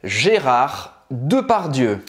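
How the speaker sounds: Gérard Depardieu said slowly, with a French throat R and a rounded "dø"; slow French name. Shown as a demonstration. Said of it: "a gente tá falando de Gérard Depardieu".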